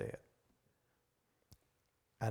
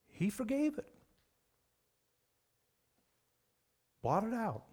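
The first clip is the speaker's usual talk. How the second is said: emphatic high preaching; 10 semitones higher than usual. Real, not acted. Preacher stresses total forgiveness.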